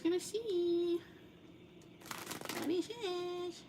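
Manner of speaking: put-on high, slow baby voice. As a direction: coo it like a baby. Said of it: "I use, like, a baby voice".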